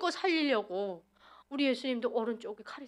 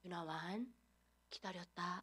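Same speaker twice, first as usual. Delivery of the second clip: gentle fatherly voice; 5 semitones lower, 14 dB quieter, slower than usual. Retold or imitated. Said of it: "정말 부드러우신 목소리로".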